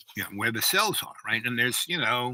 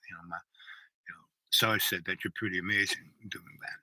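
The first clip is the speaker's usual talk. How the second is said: hushed confiding; 4 semitones lower, 10 dB quieter than usual. Retold or imitated. Confiding request to the chief.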